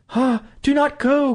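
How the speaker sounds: high, loud plea of a scared wife; frightened cry. Acted. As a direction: beg him to stay, full of dread. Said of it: "cried the wife".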